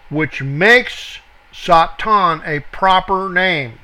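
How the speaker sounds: ordinary talk, nothing marked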